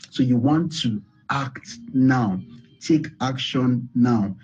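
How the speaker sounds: each word set down slowly and firmly; slow, weighty urging; slow emphasis. Real, not acted. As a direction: drive the call to act home, word by word. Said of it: "I'm emphasizing on this".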